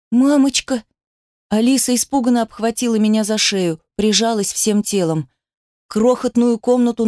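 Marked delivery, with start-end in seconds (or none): 0.1-0.8 put-on higher-pitched scared little girl; frightened child. A narrator's reading. Scared daughter calling to mother, frightened. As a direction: call out for mother in alarm.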